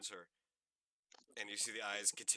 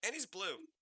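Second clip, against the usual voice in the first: higher, wondering; delighted surprise; 11 semitones higher, 4 dB louder than usual. Acted. Fairy girl marvelling at blue skin. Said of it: "she goes".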